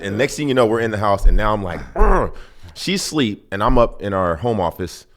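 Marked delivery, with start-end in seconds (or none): 2.0-2.4 loud, high-pitched "ugh"; frustrated groan. Retold or imitated. Husband stuck on wife's question, exasperated groan.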